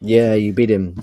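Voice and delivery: ordinary delivery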